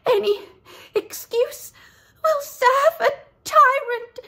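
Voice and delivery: very high, loud dying gasp; a dying lamb crying out. Acted. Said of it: "she gasped out".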